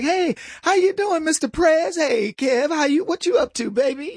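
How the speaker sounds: high, playful party greeting; chummy neighbour. Acted. Neighbours greeting at the imagined barbecue.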